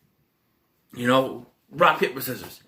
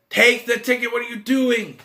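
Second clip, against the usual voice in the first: higher, louder urging; louder, higher shout at the movie; yelling at the screen; 7 semitones higher, 3 dB louder than usual. Retold or imitated. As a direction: urge the character to grab it. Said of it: "I'm like".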